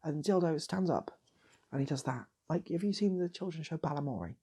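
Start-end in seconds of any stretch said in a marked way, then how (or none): none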